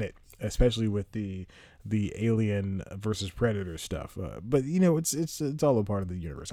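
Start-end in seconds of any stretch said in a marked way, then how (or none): none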